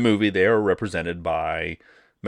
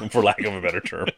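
laughing through the words; can't get it out without a real laugh; faster than usual. Real, not acted. Podcast host excusing a clumsy phrase to co-host, laughing. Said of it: "Can't say it without laughing".

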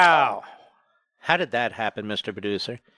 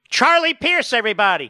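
mock introduction; 8 semitones higher, 4 dB louder than usual. Host mockingly introduces a guest.

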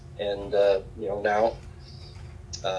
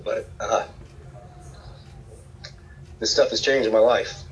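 holding back sobs. Real, not acted. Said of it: "Sorry, I get emotional".

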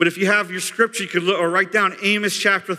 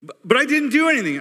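fast, much higher pleading; panicked protest; 9 semitones higher, 3 dB louder, faster than usual. Retold or imitated. Daniel protesting to the messenger, fast and panicked. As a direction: blurt it out in a panic.

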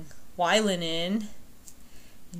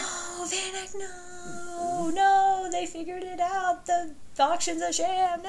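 mock despair; 11 semitones higher, slower than usual. Real, not acted. Dismay at plot twist.

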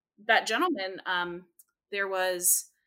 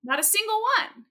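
high, louder punchline; emphatic punchline; 12 semitones higher, 4 dB louder than usual. Real, not acted. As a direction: land it with emphasis, a little incredulous. Punchline about voice exercise.

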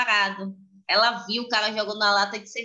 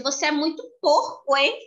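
put-on scolding child; 7 semitones higher than usual. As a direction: drag out the reproach like an indignant kid.

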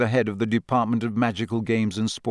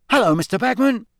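high, louder cheerful greeting; bright; 11 semitones higher, 4 dB louder, faster than usual. A narrator's reading. Fred cheerfully greeting Bagman in the pub, bright and high. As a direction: greet him brightly, pitched up. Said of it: "said Fred brightly".

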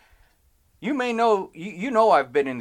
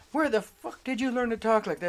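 higher-pitched mock scolding; indignant dad; 7 semitones higher than usual. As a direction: imitate a father outraged at cursing. Dad scolding teenage son, retold.

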